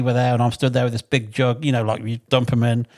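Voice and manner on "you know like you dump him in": normal talking voice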